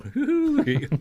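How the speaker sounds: high imitated "woo-hoo"; crowd whoop. Tour group answering the MC from their seats, whooping.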